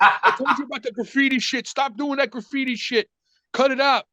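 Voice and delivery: high, exasperated telling-off, only partly yelled; retold angry yelling. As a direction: imitate an uncle barking orders to quit it. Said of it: "He's screaming and yelling at me".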